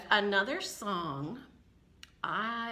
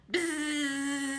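higher, long bee buzz; 5 semitones higher than usual. Acted. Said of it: "And the bee said".

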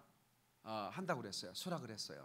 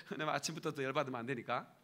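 ordinary talk, nothing marked

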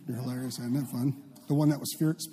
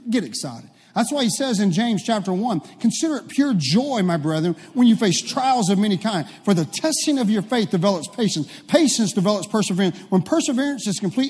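loud, raised preaching voice; fervent preaching; 5 semitones higher, 5 dB louder than usual. Real, not acted. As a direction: drive the scripture home to the congregation. Preaches scripture at full force.

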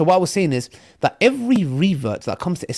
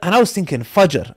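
ordinary talk

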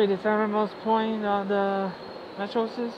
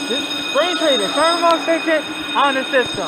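mock announcer; 6 semitones higher, 8 dB louder than usual.

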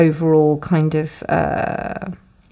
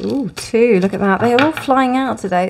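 higher-pitched delighted little ooh; higher, pleasantly surprised reaction; 6 semitones higher than usual. Real, not acted. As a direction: react lightly as an unexpected thing pops out. Cards fly out while shuffling.

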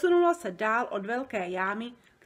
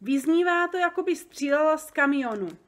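high, loud protest; loud complaint; 6 semitones higher, 6 dB louder than usual. A narrator's reading. Giant complains to Sofie in his ear, loudly. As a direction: cry out in complaint. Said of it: "zvolal Obrdobr".

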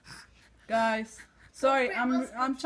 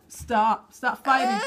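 sharp, louder stop; firm scold; 7 dB louder, slower than usual. Real, not acted. Mom telling her two kids to stop fighting.

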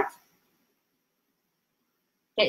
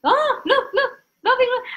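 1 excited; 13 semitones higher than usual. Real, not acted. Delighted at viewers' reactions.

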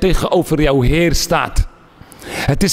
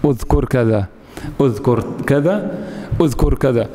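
lightly voiced, low insistent tempter; a hint of a devil nudging the mind; Shaitan whispering; 8 semitones lower than usual. Preacher voicing Shaitan distracting the worshipper, low and coaxing. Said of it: "Hij zegt".

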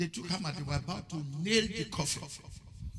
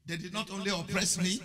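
usual speaking voice

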